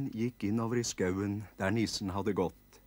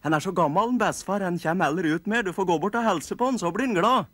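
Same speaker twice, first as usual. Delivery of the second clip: put-on higher, louder boyish voice; eager nisse boy; 9 semitones higher, 8 dB louder, faster than usual. Eldest boy telling Andersen to greet grandpa, high loud. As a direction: explain it keenly like a bossy little elf. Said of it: "ropte den største nissegutten".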